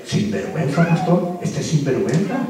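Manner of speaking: whispered tattling; a soft, low, secretive accusation; hushed accusation. Retold or imitated. Congregant retold whispering an accusation.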